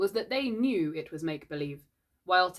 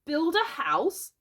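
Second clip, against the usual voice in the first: high, slow disbelieving question; high-pitched incredulity; astonished exclamation; 9 semitones higher, 3 dB louder, slower than usual. A narrator's reading. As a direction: draw it out in disbelief. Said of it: "exclaimed John".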